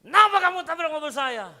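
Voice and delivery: loud, high furious yell; high-pitched roadside outburst; acted angry accusation. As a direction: bellow it at the other driver. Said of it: "nadanya turun kan?".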